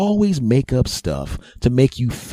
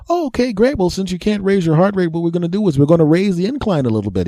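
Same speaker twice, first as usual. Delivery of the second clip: much higher, brisk doctor; chirpy clinician raising the incline quickly; 8 semitones higher than usual. Retold or imitated. Doctor explaining the incline to the patient, high and brisk.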